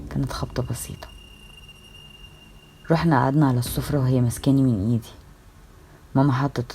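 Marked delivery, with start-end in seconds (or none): 0.1-1.1 soft breathy whisper; hushed comforting whisper. Acted. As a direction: whisper it close while patting her back. Sister hugs her, soft reply.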